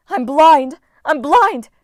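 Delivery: high, loud panicked shouting; frantic goddess wail; panicked cry. A narrator's reading. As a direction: scream in blinded panic. Goddess blinded, staggering.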